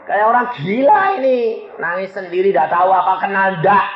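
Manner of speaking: put-on high childlike whining complaint; merengek. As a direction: whine it up high, like a pestering kid. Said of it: "Saya bilang, ngerengek". (merengek dengan suara tinggi)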